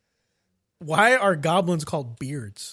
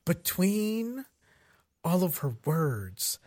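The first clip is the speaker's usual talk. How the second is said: slow spaced-out words; 8 dB quieter, slower than usual. Shown as a demonstration. Mocking the mage's slow speech, pausing between words. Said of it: "she's breathy".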